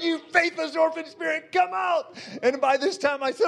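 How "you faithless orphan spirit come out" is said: high shouted command; shouted rebuke. Retold or imitated. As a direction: cast the spirit out. Prophet shouting again.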